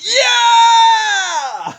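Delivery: huge high joyful yell. Retold or imitated. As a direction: belt it like a thrilled fan. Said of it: "he yells out".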